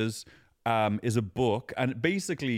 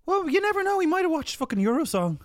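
excited; 3 dB louder than usual. Real, not acted. Joking about Jason Momoa.

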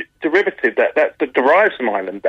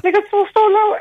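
mock a Cork voice sliding ever higher; climbing Cork lilt. Shown as a demonstration. Comedian shows radio host how Cork people talk, climbing higher. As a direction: pitch climbs very high, near tears. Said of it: "they go higher and higher".

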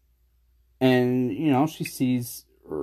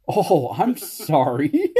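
loud, higher, drawn-out fake sorry; mock apology; 6 semitones higher, 5 dB louder, much slower than usual. Husband answering wife who wants her show in peace, mock apology. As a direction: stretch out an exaggerated, sarcastic apology.

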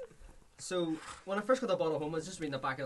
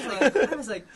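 laughing; 6 semitones higher, 10 dB louder, faster than usual. Real, not acted. Laughing while telling the story.

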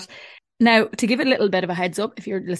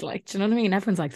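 ordinary talk, nothing marked

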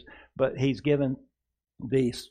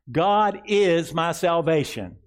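slow, higher and louder words to echo; slow proclaimed declaration; 5 semitones higher, 5 dB louder, slower than usual. Shown as a demonstration. Pastor leading the congregation in a slow proclamation. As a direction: ring it out slowly. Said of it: "Say this".

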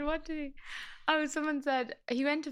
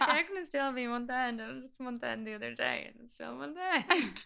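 mock exasperation; 4 semitones higher than usual. Partner mocks the never-ending month end, exasperated. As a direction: act out baffled griping.

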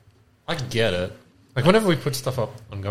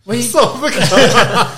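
high, loud, drawn-out cheer through laughter; laughing mock celebration; 12 semitones higher, 8 dB louder, much slower than usual. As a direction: imitate a proud seller while cracking up. Laughing mock celebration of cheap sale.

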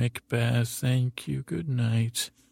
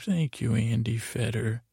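ordinary talk

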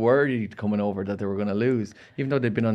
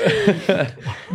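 laughing; 9 semitones higher, 4 dB louder than usual. Real, not acted. Laughing before next question.

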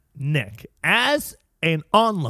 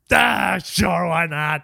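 hammed-up agreement; 3 semitones higher, 4 dB louder, slower than usual. Man agreeing to his lady's idea, hammed-up and loud. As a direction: belt it out like a goofy pushover. Acting out the boyfriend happily agreeing.

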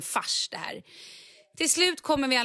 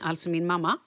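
ordinary delivery

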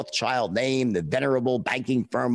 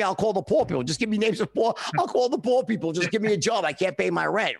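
mock desperate pleading; 6 semitones higher, faster than usual. Begging for job, desperate.

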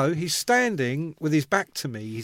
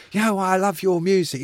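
[name] voice; 3 semitones higher, 4 dB louder than usual. Retold or imitated. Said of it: "in his voice, he was like".

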